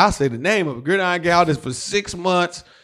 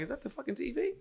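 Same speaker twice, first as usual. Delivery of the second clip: high, very soft mock whisper; hushed disbelief; 8 semitones higher, 18 dB quieter, faster than usual. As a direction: squeak it under your breath. Overhearing Denzel's voice next door.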